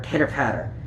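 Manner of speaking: ordinary delivery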